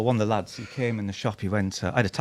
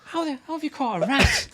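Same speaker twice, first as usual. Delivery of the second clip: higher, disbelieving question; mate baffled by the rat, higher; incredulous. Retold or imitated. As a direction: ask it like you can't believe it.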